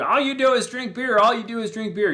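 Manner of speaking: high-pitched imitation of a nagging yell; mock screaming wife. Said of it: "she's screaming at you".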